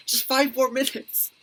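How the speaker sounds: slow, high whiny plea; drawn-out kid plea. Retold or imitated. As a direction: stretch it out like a kid begging. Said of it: "that's me when I was, like, nine years old".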